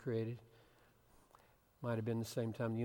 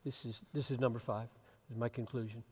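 ordinary talk, nothing marked

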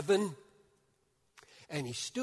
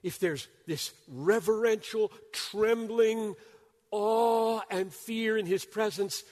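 higher, louder, driving fast on the key point; full-force preaching; 8 semitones higher, 8 dB louder, slower than usual. Acted. Preacher firing up the congregation, loud and high. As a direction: preach it hard, building through the list.

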